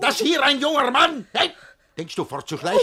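high, loud furious hey; raging scolding. Acted. Master catching a servant slinking away, high furious. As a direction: catch him sneaking off.

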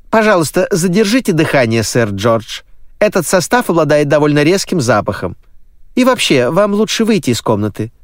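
high, brisk nanny voice. Acted. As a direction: speak up high as a fussy housekeeper. Nanny speaks while brushing the suit.

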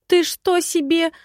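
high, loud burst of indignation; indignant. A narrator's reading. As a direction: protest sharply, pitch jumping up. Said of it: "начала возмущаться".

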